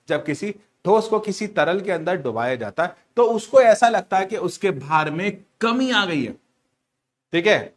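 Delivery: ordinary talk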